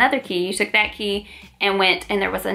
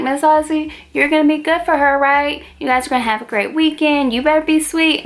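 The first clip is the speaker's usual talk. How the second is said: put-on higher, louder, cooing to an old lady; sweet-talking nurse; 6 semitones higher, 3 dB louder than usual. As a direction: sweet-talk her like a child.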